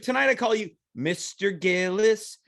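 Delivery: ordinary delivery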